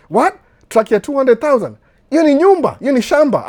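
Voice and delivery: high, loud mock outrage at the price; mock shock. Mimicking a cautious buyer shocked at the truck price, loud and high. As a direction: squeal it like the price is insane.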